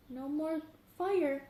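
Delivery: plain reading voice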